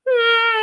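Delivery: very high, loud sustained hum; forward-placed hum. Shown as a demonstration. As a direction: hum high into the front of the face. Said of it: "Now put it forward again".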